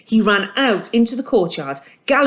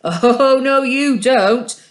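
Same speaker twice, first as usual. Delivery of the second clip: louder, slow stern warning; drawn-out warning to stop; warningly; 6 semitones higher, 5 dB louder, much slower than usual. A narrator's reading. Hussan warning the dog off his snack van, loud and slow. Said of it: "Hussan said warningly".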